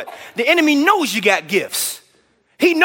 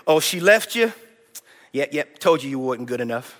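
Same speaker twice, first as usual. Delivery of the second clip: mocking enemy taunt; 8 semitones lower, 4 dB quieter than usual. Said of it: "Trash talk like this".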